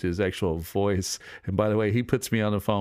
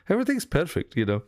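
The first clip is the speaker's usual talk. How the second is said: high, sing-song "Everything's perfect" falling; light Indian-English impression of his teacher; imitating teacher's lilt; 9 semitones higher, faster than usual. Showing how he sounds after the teacher's call, high lilting. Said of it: "there's a way he sounds".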